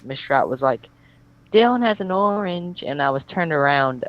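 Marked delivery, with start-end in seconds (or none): 1.5-2.8 put-on high, slow tattling child voice; sing-song tattletale; childish voice. Teacher tattles on Dylan in a childish voice. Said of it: "in the most childish voice".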